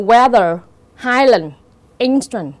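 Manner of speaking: "weather, highland, instant", each stressed on its first syllable. Shown as a demonstration. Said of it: "dấu nhấn nó sẽ rơi vào âm tiết thứ nhất". (nhấn âm tiết đầu của "weather, highland, instant")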